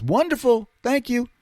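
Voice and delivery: high-pitched, over-polite praise; put-on politeness. Guest to host about the waffle, put-on polite delight. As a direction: answer high, playing along politely. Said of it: "smart enough to play the game".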